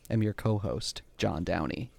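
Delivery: creaky vocal fry at the end. Real, not acted. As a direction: let the voice fry out low. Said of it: "I got a little vocal fry at the end".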